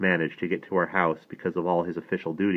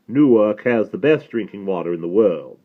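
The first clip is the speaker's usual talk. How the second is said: louder Southern rabbi, weighing every word; deliberate, considered rabbi; 3 semitones higher, 7 dB louder than usual. A narrator's reading. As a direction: pronounce it with deep consideration. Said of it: "with deep consideration".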